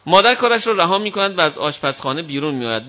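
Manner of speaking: normal talking voice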